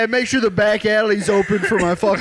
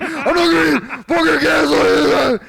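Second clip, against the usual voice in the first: angry shout; 6 semitones higher than usual. Retold or imitated. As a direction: yell it like you're fed up. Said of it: "where Matt then goes".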